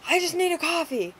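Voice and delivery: high, loud imitated yelling; mock yell. Frantic customer yelling for his coffee, mock loud yell. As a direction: belt it like an agitated customer. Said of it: "yelling at me".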